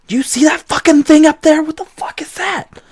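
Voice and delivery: high, hushed gnome asking what the customer is; hissed panic under the bar, pitched high; whispering gnome. Acted. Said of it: "whispering, like".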